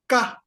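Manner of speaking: Ka said high and sharp, short and clipped; teaching a syllable. Shown as a demonstration. Teacher to students, modelling the sound clearly. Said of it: "I will read them one by one".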